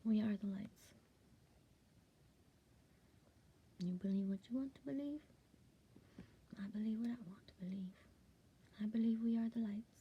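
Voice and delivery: soft, intimate statement of belief; hushed conviction. Real, not acted. Vlogger quietly confiding her belief to her viewers, hushed. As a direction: confide it softly, like a secret truth.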